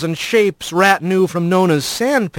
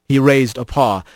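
ordinary delivery

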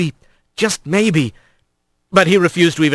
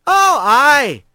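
hearty otter crewwoman; 11 semitones higher than usual. Acted. Big female otter arrives.

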